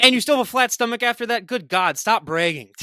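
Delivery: a friend mock-jealous of a flat stomach; mock outrage. Real, not acted. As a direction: playfully scold her for bragging.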